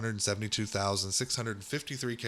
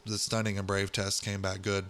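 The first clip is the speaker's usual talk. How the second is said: ordinary talk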